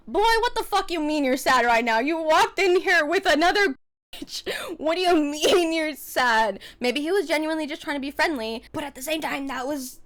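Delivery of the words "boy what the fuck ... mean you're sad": loud, high incredulous rant; telling him off in her head, hammed up; mock outrage. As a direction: call him out in mock disbelief. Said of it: "I was like".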